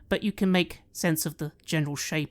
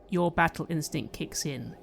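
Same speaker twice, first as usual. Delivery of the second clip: ordinary talk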